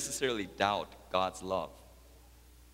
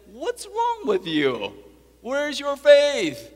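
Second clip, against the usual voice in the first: loud, high, drawn-out scolding; Jesus, scolding; 10 dB louder, slower than usual. Retold or imitated. Rebuking the disciples in the boat, acted scolding. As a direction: scold them slowly, building to a shout. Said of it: "He scolds them and says".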